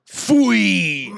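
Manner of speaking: sharp bark, then high drawn-out yell; screamed dog command. Retold or imitated. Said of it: "used to scream at Esther".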